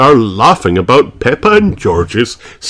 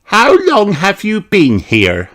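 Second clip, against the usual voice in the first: put-on high curious little girl voice; wondering aloud; Peppa asking; 12 semitones higher than usual. A narrator's reading. Peppa asking Grandad Dog, curious high voice. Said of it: "asks Peppa".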